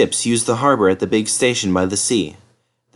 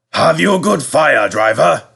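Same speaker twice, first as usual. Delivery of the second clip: fat controller; 5 semitones higher, 4 dB louder than usual. Acted. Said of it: "fat controller asks driver".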